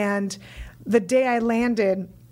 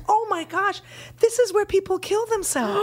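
chirpy local woman; 8 semitones higher than usual. Retold or imitated. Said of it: "And she goes".

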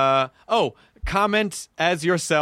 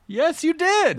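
high, haughty comic retort; much higher, put-on affront; mock indignation; 13 semitones higher, slower than usual. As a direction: snap back in hammed-up outrage. Acts haughty reply to fan.

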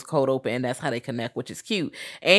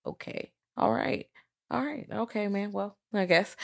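muttered resignation; 4 semitones higher, 7 dB quieter, slower than usual. Retold or imitated. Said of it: "And I was like".

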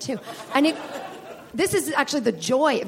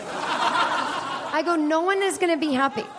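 laughing; 4 semitones higher, slower than usual. Real, not acted. Laughing while retelling.

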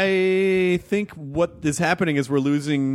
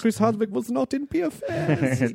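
put-on high-pitched German accent, on the edge of laughter; 8 semitones higher than usual. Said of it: "That's a good German accent".

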